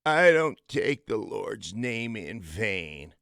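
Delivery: slow, drawn-out coach voice; slow deliberate coach. Retold or imitated. Coach opening a team meeting, slow and deliberate. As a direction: draw each word out like the old coach. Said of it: "this is how he talked".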